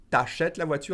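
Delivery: quick, the U dropped; fast contracted French. Shown as a demonstration. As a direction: run it together fast. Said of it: "If I said it faster, I would say".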